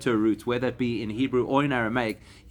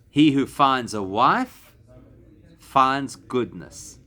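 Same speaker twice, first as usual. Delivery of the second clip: slow translation; 4 dB louder, much slower than usual. Shown as a demonstration. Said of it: "an expression that you should all know".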